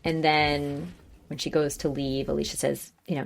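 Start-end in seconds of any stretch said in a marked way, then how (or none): none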